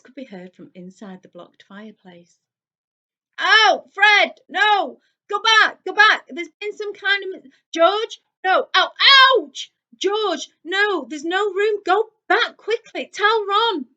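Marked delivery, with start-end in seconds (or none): 3.4-13.9 high, loud frantic yelling; yelling to a brother stuck behind him; shouting boy. A narrator's reading. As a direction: twin shouting from a blocked chimney. Trapped boys yelling in chimney.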